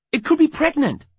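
high, loud, alarmed; panicked warning. A narrator's reading. Wife warns husband off the snake, panicked.

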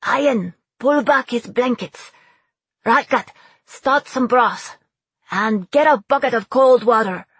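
loud, high barked orders; shouting orders. Acted. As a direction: shout commands across the deck. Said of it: "Bea was shouting".

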